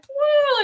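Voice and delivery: high imitated cheer; crowd woo. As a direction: mimic a crowd going wild. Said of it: "everyone's like".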